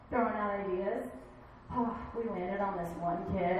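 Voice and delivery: ordinary talk, nothing marked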